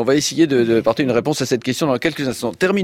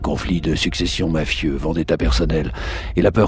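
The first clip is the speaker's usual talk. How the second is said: deep, dramatic trailer announcer; low epic trailer narration, dead serious; movie trailer voice; 5 semitones lower than usual. Shown as a demonstration. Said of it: "pour utiliser ma voix de trailer".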